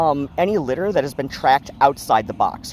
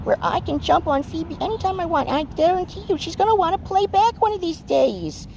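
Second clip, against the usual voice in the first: much higher, squeaky little-guy cat voice; Bran's cat voice; 12 semitones higher than usual. Acted. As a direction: speak as a cocky little cat scheming about Phoebe. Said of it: "Bran's just like this".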